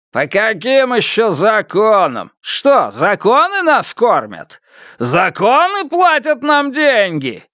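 high, scornful heckling questions; caustic. A narrator's reading. Man in the crowd challenging Фан Му, caustic. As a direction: throw the questions back with biting sarcasm. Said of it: "язвительно спросил кто-то из мужчин".

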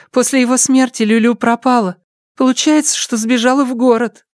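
put-on high-pitched girl's voice; high girl's voice recounting events; young village girl. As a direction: tell it as a girl, pitched well up.

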